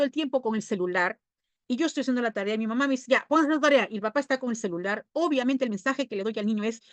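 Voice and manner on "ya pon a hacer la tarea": mother's order. Retold or imitated. Mother ordering child to do homework.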